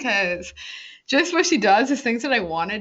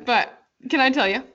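ordinary delivery

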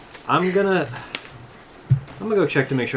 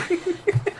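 manic laugh. Acted. After triumphant shout.

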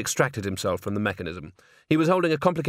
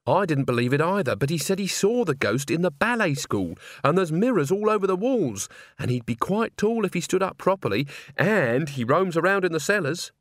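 higher, eager run of argument; earnest young woman; 6 semitones higher than usual. Acted. Agnes argues her ghost theory to the organist, higher and earnest. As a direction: pile up the evidence insistently.

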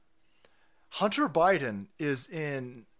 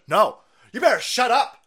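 loud, high mock scold; 8 semitones higher, 10 dB louder than usual. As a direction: bark it like a bossy pundit. Voicing MSNBC telling viewers off.

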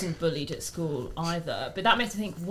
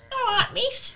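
put-on high, cheeky teenager voice; mocking teen impression; slower than usual. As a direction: greet teacher like a cocky teen. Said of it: "do a voice of a teenager".

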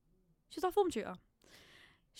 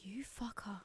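whispered curse; 12 dB quieter, much slower than usual. Retold or imitated. Silent curse at teacher.

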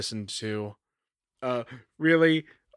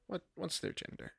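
whispered aside; 6 semitones higher, 15 dB quieter than usual. Real, not acted.

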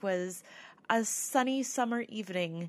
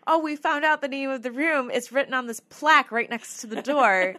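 loud, raised recorded self; imitated shouting; 6 semitones higher, 10 dB louder than usual. Investigator hears own recording blaring back, shouting. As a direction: blare it out, higher and much louder. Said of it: "my voice is blaring back in my ears again, like, shouting at myself".

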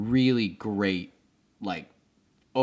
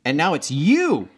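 playful accusation; 6 semitones higher, 7 dB louder than usual. Acted.

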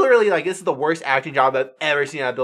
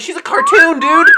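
high, loud yelp of disbelief; exasperated shout; 9 dB louder, slower than usual. Real, not acted. Reviewer yelling at a cartoon being sexualized, exasperated shout. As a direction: blurt it out in outrage.

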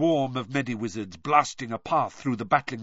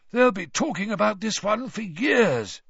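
hoarse, higher voice; 8 semitones higher than usual. A narrator's reading. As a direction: speak with a worn-out hoarse voice. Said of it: "he said hoarsely".

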